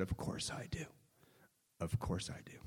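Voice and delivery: whispered admission, barely voiced. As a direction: breathe the words out in a whisper. Whispered admission to his brother.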